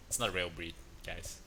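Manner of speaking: ordinary talk, nothing marked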